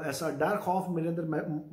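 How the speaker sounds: ordinary talk, nothing marked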